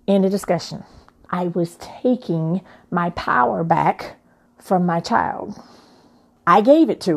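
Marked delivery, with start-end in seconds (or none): none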